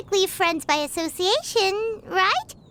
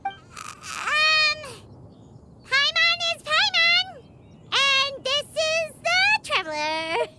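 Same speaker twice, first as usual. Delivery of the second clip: shy nervous greeting; 6 semitones higher, slower than usual. Acted.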